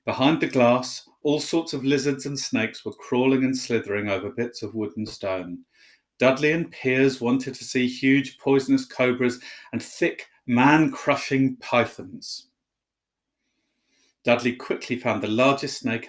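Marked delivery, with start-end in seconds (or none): none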